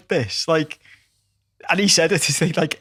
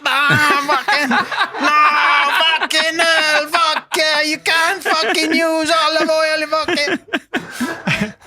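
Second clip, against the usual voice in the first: put-on high, loud, slow ranting Italian accent with laughs; Manc Italian impression; 13 semitones higher, 4 dB louder, much slower than usual. Mimicking an outraged Italian boyfriend, loud and high Italian accent. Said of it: "Like my Italian impression".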